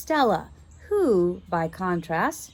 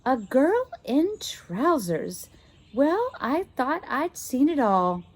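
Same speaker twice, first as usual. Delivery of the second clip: prim governess; 6 semitones higher, slower than usual. Acted. Governess remarks.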